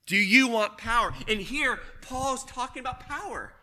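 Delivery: usual speaking voice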